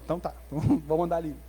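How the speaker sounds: low, soft, grudging agreement; resigned king. Acted. King yielding after the queen's look, resigned. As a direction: give in quietly under your breath.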